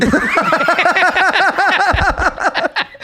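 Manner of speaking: high cackling laugh. Real, not acted. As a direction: burst out laughing. Big burst of laughter.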